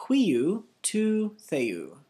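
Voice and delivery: Huiou tu theou stretched very slowly; syllable by syllable, slowly; very slow model. Shown as a demonstration. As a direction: drag each word out for learners. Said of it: "And then the last part".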